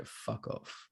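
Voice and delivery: whispered dismissive brush-off; unbothered whispered fuck off; voicing the aloof dog. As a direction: hiss it like a bored dog. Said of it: "he'll just look at you and go".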